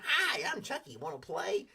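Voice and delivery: high squeaky doll greeting, a light impression; Chucky doll voice. Host imitates the Chucky doll for viewers, high. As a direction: pitch up into a toy doll. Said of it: "the little doll running around".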